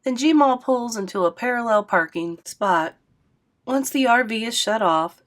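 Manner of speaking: ordinary delivery, nothing marked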